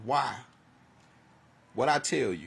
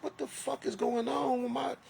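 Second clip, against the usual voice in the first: stunned disbelief; 11 semitones higher, 3 dB quieter than usual. Retold or imitated. Quoting own disbelief.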